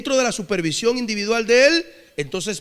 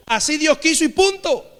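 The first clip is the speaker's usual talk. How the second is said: higher, firm declaration; raised-pitch emphatic verdict; emphatic finality; 6 semitones higher than usual. Real, not acted. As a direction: lay it down as the final word. Preacher firmly closes the argument.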